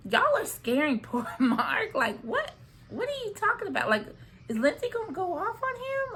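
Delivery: high-pitched, laughing disbelief; incredulous. Acted. Reacting to a dad's warning about Lindsay, incredulous. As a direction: pitch jumps up in mock alarm.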